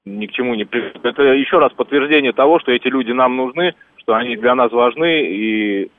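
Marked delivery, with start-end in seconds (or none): none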